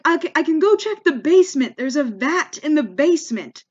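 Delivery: retold fluster, pushed up high; flustered clerk. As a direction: imitate a rattled clerk scrambling to help. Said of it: "the guy, he freaks out".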